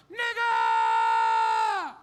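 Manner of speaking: very high, loud yelled slur. Retold or imitated. Heckler yells at the comic from the crowd, very loud and high. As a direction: scream it across the room. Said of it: "he just yelled out".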